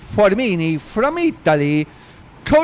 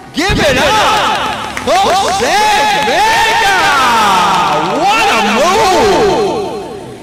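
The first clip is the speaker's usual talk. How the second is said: very high, loud, drawn-out cheer; jubilant shout; 10 semitones higher, 7 dB louder, much slower than usual. Real, not acted. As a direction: roar the winner's name, stretching every word. Roaring for the race winner.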